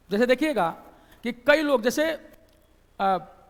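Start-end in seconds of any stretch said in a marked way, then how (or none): none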